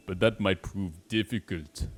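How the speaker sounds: badly faked Austrian German accent; Arnold Schwarzenegger. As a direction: play a game character with a hammy Austrian accent. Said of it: "some sort of African accent".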